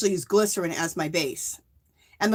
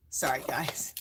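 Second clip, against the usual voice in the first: whispered apology; 8 semitones lower, 10 dB quieter, slower than usual. Real, not acted. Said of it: "That was a long story".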